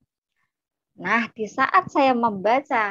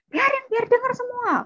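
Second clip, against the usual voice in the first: high-pitched screech, imitating angry older sibling; defiant yelling. Older sibling refuses to be hushed, high defiant yell. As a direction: shout so everyone hears. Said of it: "Nggak usah teriak-teriak".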